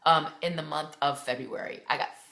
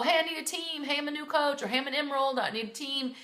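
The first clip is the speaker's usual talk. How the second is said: higher, perky request voice as if posting for a team; chirpy mock post; 7 semitones higher, faster than usual. Coach imitating a new member posting for a team, higher and eager. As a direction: sound eager and bright like a new coach asking in. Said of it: "Post below".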